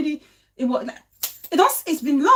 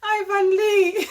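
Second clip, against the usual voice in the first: mock wail; 6 semitones higher, 3 dB louder, slower than usual. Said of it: "laughing wail imitation".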